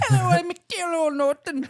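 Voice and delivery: hammed-up Irish intro with a giggle; mock Irish accent. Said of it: "Irish Terrell".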